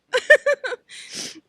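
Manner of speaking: high amused laugh. Real, not acted. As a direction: burst into a laugh. Bride laughs during vows.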